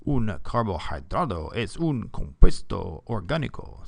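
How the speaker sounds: best-effort Spanish accent, swinging pitch. Shown as a demonstration. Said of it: "my best Spanish accent for you".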